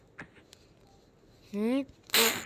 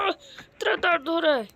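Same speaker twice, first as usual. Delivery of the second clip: pained cry. Acted. Character cries out in pain.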